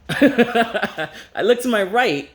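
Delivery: slow, high, laughing through words. Real, not acted. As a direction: laugh as you say it. Laughing while retelling.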